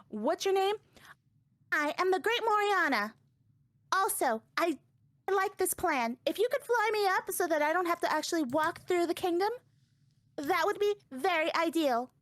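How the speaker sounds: put-on high, haughty little-girl voice; sassy child character. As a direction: play her as a smug, secretive brat. Child introduces herself, asks favour.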